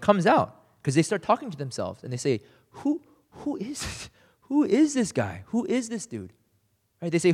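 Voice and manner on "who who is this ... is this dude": high, hushed, wondering who is this; voicing stunned disciples, lifted and breathless; awed disciples. Disciples in the boat asking about Jesus, awed and high.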